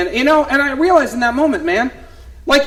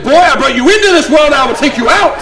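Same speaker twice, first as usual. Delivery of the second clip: mock angry mom; 3 semitones higher, 6 dB louder than usual. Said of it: "She's like".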